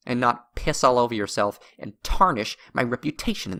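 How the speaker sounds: letting the anger boil over at a friend. A narrator's reading. Edward berating drunk Oliver at the bar, rising angry outburst. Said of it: "Edward's voice got louder".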